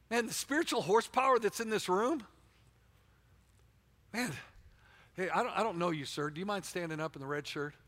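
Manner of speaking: ordinary talk, nothing marked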